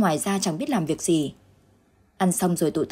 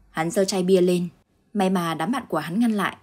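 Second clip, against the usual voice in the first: usual speaking voice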